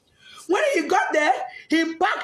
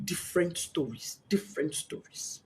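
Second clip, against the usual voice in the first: faint whisper; 8 semitones lower, 11 dB quieter than usual. Real, not acted. Trailing off in grief.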